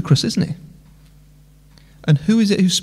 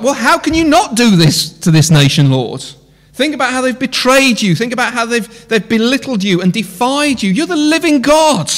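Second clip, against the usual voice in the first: raised, urgent plea to the Lord; pleading with God; 7 semitones higher, 4 dB louder than usual. Pretend. Preacher pleading with God, high and urgent. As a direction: press the Lord hard, louder and higher.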